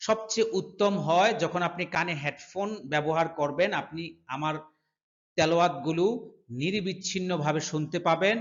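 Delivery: usual speaking voice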